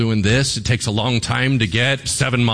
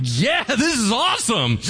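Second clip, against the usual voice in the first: lepers' glee; 11 semitones higher than usual. Retold or imitated. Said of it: "they're like".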